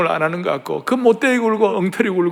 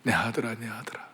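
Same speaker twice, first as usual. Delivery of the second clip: soft lament; 9 semitones lower, 16 dB quieter than usual. Acted. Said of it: "영문학에 유명한 얘기가 나와요".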